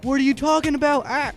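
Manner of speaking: normal talking voice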